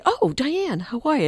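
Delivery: high, lilting friendly greeting; welcoming. Retold or imitated. Client softening as he recognises his coach on the phone, welcoming. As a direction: pitch jumps up in pleased recognition. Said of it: "it's the welcoming and that welcoming connection".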